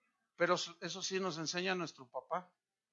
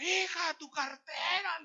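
high, drawn-out mother's call; stretched high-pitched holler to someone in the house; 11 semitones higher, slower than usual. Retold or imitated. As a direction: imitate a mom hollering for her daughter. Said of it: "le grita a su hija".

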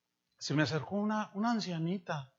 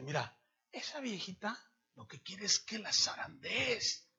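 confiding aside; 8 dB quieter than usual. Retold or imitated. Pastor confides quietly.

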